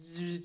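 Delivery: "zzz" buzzing reply; imitated cicada buzz. Cicada replying to the man whose shoulder it rides, imitated buzz. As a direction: buzz an answer like an insect. Cicada answers through Google Translate.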